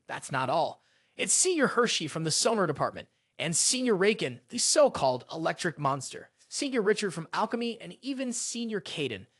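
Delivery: ordinary delivery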